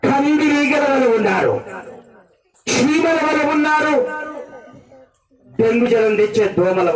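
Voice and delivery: high, ringing sermon cry in repeated phrases; full-force preaching. Real, not acted. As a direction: hurl each phrase at the congregation, high and drawn out.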